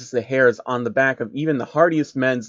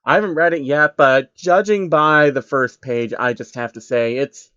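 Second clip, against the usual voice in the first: ordinary delivery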